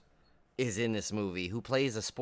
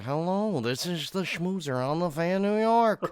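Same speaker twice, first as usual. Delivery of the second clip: higher, slowed, showy radio-host greeting; radio sportscaster impression; 6 semitones higher, slower than usual. Podcast host imitating favorite sportscaster's on-air greeting, hammed up. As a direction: ham up a late-night sports radio sign-on. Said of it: "imitations of him all the time".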